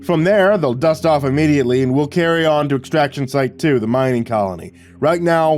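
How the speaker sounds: commander's briefing. A narrator's reading. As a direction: address troops before a raid. Commander briefs his men.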